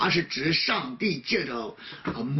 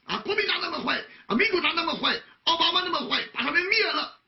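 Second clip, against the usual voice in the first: higher, mock indignant rant; mocking angry believer; 8 semitones higher, faster than usual. Preacher mimics a believer cursing political enemies, mock indignant. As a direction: parody the hothead who wants enemies wiped out. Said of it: "所以有的时候我们不要说".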